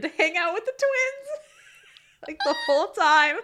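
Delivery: words breaking into high laughter; laughing through it. Retold or imitated. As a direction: lose it to laughter mid-joke. Jokes about goat and twins.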